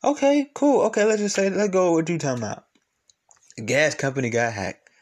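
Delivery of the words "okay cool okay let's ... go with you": high, loud mock agreement; sarcastic okay. Real, not acted. Conceding to Tommy, loud sarcastic okay. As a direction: toss it off sarcastically.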